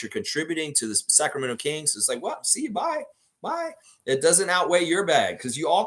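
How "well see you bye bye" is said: very high-pitched, put-on goodbye; mock dismissive farewell. League dropping a troubled player, mock dismissive farewell. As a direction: send them off with a high mock bye-bye.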